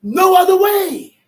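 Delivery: loud fervent preaching. Real, not acted. Preacher shouting.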